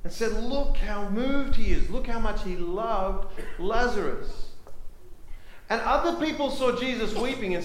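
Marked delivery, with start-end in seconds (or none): 0.3-4.5 raised, loud, drawn-out wonder; moved admiration. Acted. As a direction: marvel slowly and emphatically in a higher voice. Acts out admiring onlookers.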